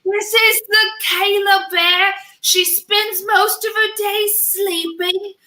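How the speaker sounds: put-on high, slow Australian wildlife-host accent; slow, marveling Australian narration of a bear; Steve Irwin impression. Podcast host voicing Steve Irwin introducing her as a bear, Australian accent. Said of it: "I don't know what accent I ended up with".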